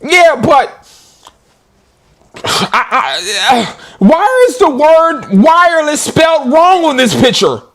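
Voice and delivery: high puzzled imitation of a customer; mock customer voice. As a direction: whine the question like a confused buyer. Mimicking customer complaint.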